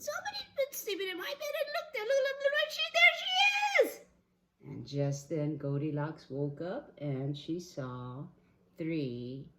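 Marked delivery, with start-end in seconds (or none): 0.0-3.9 very high, frantic squeal; Baby Bear. Acted. Baby Bear spotting the sleeper in his bed, shrieking. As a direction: gabble higher and louder into a shriek.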